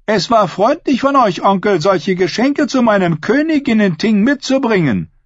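high, projected voice of a bold woman; declaimed compliment, pitched high; public praise. A narrator's reading. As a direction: praise him for the whole assembly to hear. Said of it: "lobte sie Victarion".

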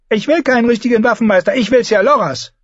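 put-on high, fast childish demand; insistent child. A narrator's reading. Boy tells his mother whom he wants as teacher, high and insistent. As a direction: rush out a pouty refusal in a boy's high voice.

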